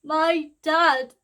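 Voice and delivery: high, very loud cry of disbelief; anguished shout. Acted. Daughter crying out at her father's crime, anguished shout.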